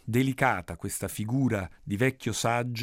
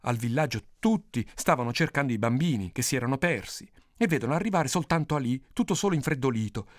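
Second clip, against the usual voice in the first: usual speaking voice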